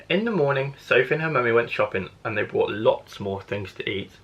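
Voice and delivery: ordinary delivery, nothing marked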